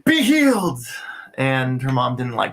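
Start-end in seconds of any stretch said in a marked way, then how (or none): 0.1-0.8 high, ringing mock healing command; mock Jesus. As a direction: proclaim it like a hammed-up miracle worker. Jokingly plays Jesus to the child.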